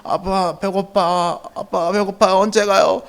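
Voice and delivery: mild whiny plea to a parent; hungry child. Retold or imitated. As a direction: imitate a hungry kid nagging a parent. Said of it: "또 배도 고프잖아요".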